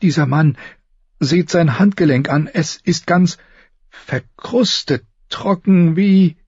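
nervous, high, halting voice; high and hesitant, feeling for each word; Samwell Tarly. Acted. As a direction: stammer timidly to a superior. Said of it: "Sams nervöse, hohe Stimme".

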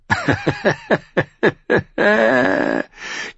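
hohes, spöttisches Auflachen; lautes Lachen. A narrator's reading. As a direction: lach ihn hämisch aus. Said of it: "Lark von den Sisters lachte". (Lark lacht Paul hoch und spöttisch aus)

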